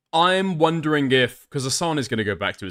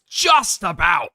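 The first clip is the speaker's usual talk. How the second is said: a loud, slow, scoffing "just about"; exasperated outburst; 4 semitones higher, 4 dB louder, much slower than usual. Real, not acted. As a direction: stretch it out and bark it in disbelief. Mocking the clip's claim.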